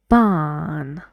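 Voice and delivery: ordinary talk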